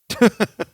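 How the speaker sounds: quick high burst of laughter; short laugh. Real, not acted. Podcast host laughing after admitting he'd be disappointed, a short high laugh. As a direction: laugh at your own blunt opinion.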